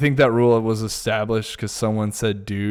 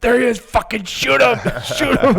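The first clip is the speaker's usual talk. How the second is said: high shouted rock-star excitement; excited yell; 13 semitones higher, 3 dB louder than usual. Retold or imitated.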